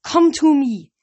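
loud, high, urgent summons; pleading cry, pushed up high; desperate plea. A narrator's reading. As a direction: call him over desperately.

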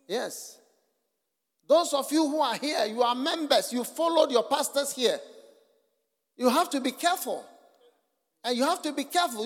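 fiery preacher warning his members; preaching at full force. Real, not acted. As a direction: press the warning home, building.

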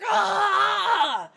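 high sudden cry of pain; a monster's shocked pained ah. Acted. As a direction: yelp as if struck by the hammer. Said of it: "And he cries out in pain".

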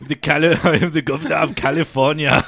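loud, hammed-up Austrian strongman accent; Arnie. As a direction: boom it out as the bodybuilder-politician. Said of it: "you did a vaguely Arnie-ish thing".

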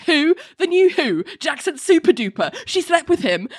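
blurted gossip. Retold or imitated. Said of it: "is like".

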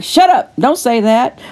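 sharp high snap, then settling; sassy street-wise Holy Spirit. Retold or imitated. Inner voice scolding her, sassy high snap.

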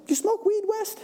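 high, laughing jab; teasing laugh. Retold or imitated. Producer needling West about his joy, high laughing tease.

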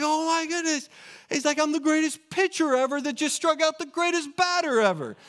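put-on high, excited little boy calling his own game; little kid announcer. Kid in his backyard cheering himself on as pitcher, high excited voice.

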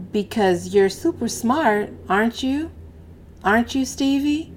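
母亲慢声哄沉默的男孩; coaxing. Acted. Mother coaxing sulking boy.

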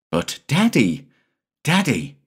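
put-on pleading little boy. A narrator's reading.

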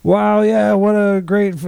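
a burst of high, loud fake hype. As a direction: gush like a celebrity on the carpet. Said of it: "I can't do the fake hype".

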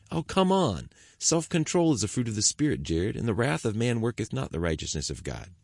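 a touch of weary frustration; frustrated. Acted. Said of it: "David quoted the scriptures in a frustrated tone".

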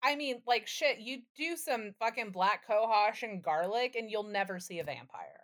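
usual speaking voice

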